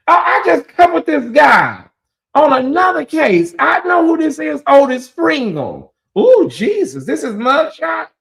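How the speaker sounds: suddenly spot him and cry out; excited gasp of recognition. Real, not acted. Recognizing the man in photo.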